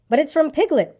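high-pitched startled exclamation; high storybook cry of surprise. A narrator's reading. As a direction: exclaim at a sudden discovery. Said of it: "cried Christopher Robin when he had read it".

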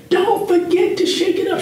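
high mock wife voice; wife's reminder. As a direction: pitch way up to imitate his wife. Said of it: "but she said".

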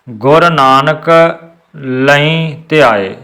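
ਲਈ said with a nasal bindi sound; slow, nasalise ਲਈ; ਲਈ ਬਿੰਦੀ ਨਾਲ; slow recitation. Shown as a demonstration. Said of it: "ਲਈ ਬੋਲਣਾ ਹੈ ਬਿੰਦੀ ਲਾ ਕੇ".

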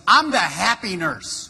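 loud, put-on cross nurse voice; unhappy "happy nurse". Nurse introducing herself to the patient, crossly. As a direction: announce it loudly, sounding anything but happy. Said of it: "You don't seem very happy".

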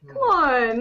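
a mock whine at the streaming service; exasperated. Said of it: "I'm like".

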